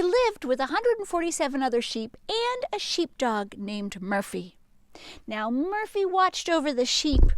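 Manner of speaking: lively read-aloud voice; animated storybook reading; story narration. A narrator's reading. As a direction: tell it like a picture book to kids.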